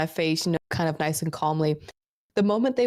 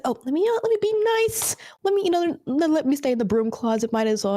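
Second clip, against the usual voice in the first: high, sing-song fake sweetness; high mock sweet voice; 10 semitones higher, faster than usual. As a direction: chirp it like a false innocent. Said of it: "it's like".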